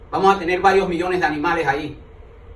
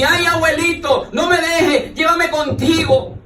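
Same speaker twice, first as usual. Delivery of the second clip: hammed-up wailing granddaughter; 9 semitones higher, 3 dB louder, slower than usual. Granddaughter wailing again at grave.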